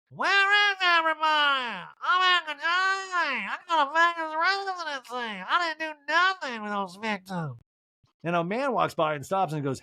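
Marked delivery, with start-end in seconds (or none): 0.1-7.6 Erica impression. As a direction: drag out a lost, high calling voice. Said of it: "Erica in the main room alone calls out loud".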